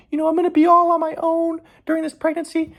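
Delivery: very high mock-weepy falsetto; put-on tearful girlfriend voice, high-pitched; imitating crying girlfriend. Pregnant girlfriend crying to him, in mocking high falsetto. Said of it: "she was crying and she was like".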